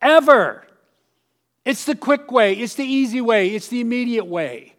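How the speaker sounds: crowd that saw it coming; knowing crowd. Retold or imitated. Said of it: "audience is going".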